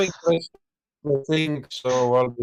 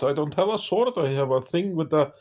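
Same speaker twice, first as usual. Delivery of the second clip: normal talking voice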